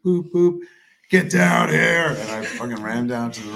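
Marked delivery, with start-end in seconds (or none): 0.0-2.1 louder, higher call over the intercom; panicked call down the phone line; intercom summons. Retold or imitated. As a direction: buzz him down urgently. Wife calling over intercom.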